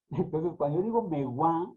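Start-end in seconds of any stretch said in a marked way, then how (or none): 1.0-1.7 megua said as me-GUÁ, final syllable stressed; accent the end of megua; stress on last syllable. Shown as a demonstration. Teacher showing the accented form, megua stressed on the end. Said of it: "en estos momentos se está levantando".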